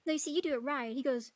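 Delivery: normal talking voice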